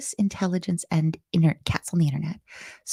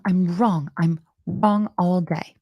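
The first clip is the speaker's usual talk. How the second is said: normal talking voice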